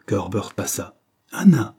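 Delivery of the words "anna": calling out. Acted. Herbert calls out to Anna.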